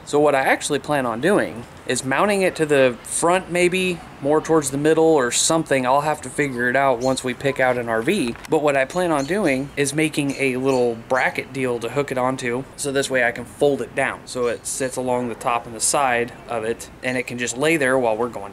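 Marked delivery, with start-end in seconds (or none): none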